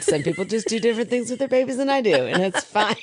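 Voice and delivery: fast, giggling through it; barely holding back laughter; laughing. Real, not acted. Laughing while reading again.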